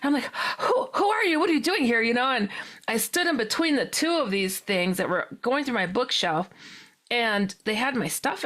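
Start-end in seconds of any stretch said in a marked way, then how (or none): 0.6-2.0 high and rushed, stumbling on who; demanding answers in a panic; frantic questions. Retold or imitated. Woman confronting aliens in her living room, high and frantic. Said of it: "talking really fast".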